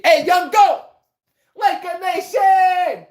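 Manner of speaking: high, loud, stretched shout to the chat; hype shout-out. Real, not acted. Streamer shouting out a Lakers fan in chat, hyped yell. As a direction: call out Laker Nation like an arena announcer.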